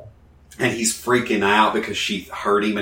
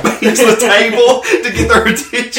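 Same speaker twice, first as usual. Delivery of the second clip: laughing; 13 semitones higher, 7 dB louder than usual. Real, not acted. Laughing while retelling.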